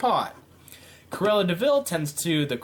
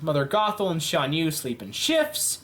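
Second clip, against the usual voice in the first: ordinary delivery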